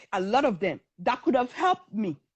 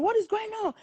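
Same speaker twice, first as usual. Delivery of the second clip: high-pitched shocked question; 10 semitones higher, faster than usual. Retold or imitated. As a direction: imitate a stunned friend stepping in. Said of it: "She was shocked".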